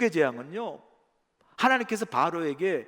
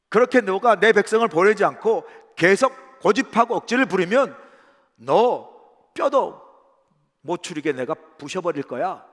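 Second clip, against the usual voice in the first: loud, pressuring threat from God; acted scolding, hammered out loud; threatening rebuke; 9 dB louder than usual. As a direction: browbeat a stubborn king with forceful threats. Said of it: "책망하고 협박하는 그런 톤".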